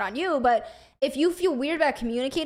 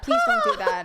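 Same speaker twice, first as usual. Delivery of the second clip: very high squeaky pleading; mock Mickey plea. Quoting her boyfriend, high squeaky plea. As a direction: beg in a high cartoon squeak.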